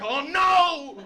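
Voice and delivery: very high, drawn-out cry of alarm; slow, high-pitched mock distress; mock worried panic. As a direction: cry out in hammed-up desperation. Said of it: "I was just as worried".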